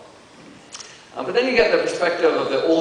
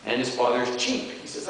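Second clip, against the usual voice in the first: normal talking voice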